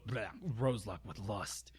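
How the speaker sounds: ordinary delivery, nothing marked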